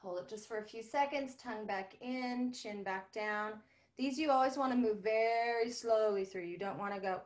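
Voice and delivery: ordinary talk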